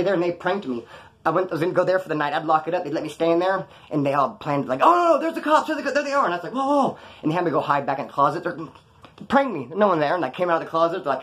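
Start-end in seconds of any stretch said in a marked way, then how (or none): 4.8-6.2 high-pitched, hammed-up panic; urgent fake warning, voice jumping up; mock alarm. Pranksters fake a police raid on friend, mock alarm. 6.5-7.0 high-pitched startled reaction. Retold or imitated. Reacting to friends' police prank, startled.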